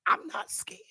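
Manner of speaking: whispered dare; taunting whisper. Real, not acted. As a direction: breathe it like a dare. Taunting the jealous viewers.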